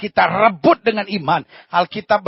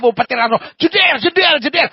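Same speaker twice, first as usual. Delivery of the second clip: loud, higher jeder like thunder; thunder sound effect; 8 semitones higher, 5 dB louder than usual. Acted. Pastor mocks the thunder strike, loud and high. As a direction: crash it out like lightning.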